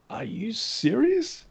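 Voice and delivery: high, slow, drawn-out disbelief; stunned disbelief. Retold or imitated. Retelling his reply to the coworker, slow high disbelief. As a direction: stretch it out like you can't believe your luck.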